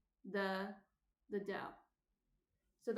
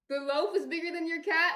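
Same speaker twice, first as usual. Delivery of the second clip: higher and much louder, amused disbelief; surprised; 6 semitones higher, 10 dB louder than usual. Real, not acted. Streamer reads viewer's cat comment, loudly surprised. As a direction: react as if the comment caught you off guard.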